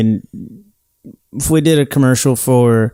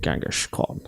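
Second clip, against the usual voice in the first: whispered; 14 dB quieter than usual. Pretend. Echoes the Genghis Khan bit.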